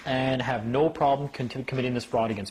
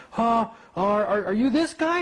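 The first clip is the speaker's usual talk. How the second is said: surprised clerk; 9 semitones higher, 3 dB louder than usual. Retold or imitated. Clerk surprised, voiced high.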